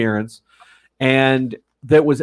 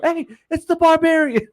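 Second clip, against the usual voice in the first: calling out excitedly; 13 semitones higher than usual. Retold or imitated. Regis calling out to fan across the hotel, high and excited. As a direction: hail her across the crowd with delight. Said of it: "he's just screaming out".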